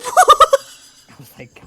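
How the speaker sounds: high, loud burst of laughter through the words; cracking up while trying to talk; laughing hard. Real, not acted. Laughing while retelling insult.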